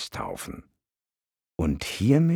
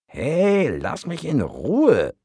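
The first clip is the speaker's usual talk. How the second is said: much higher, louder, drawn-out yell; bellowing; 13 semitones higher, 6 dB louder, slower than usual. Acted. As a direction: yell it slowly at the deva. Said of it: "brüllte der Wurm".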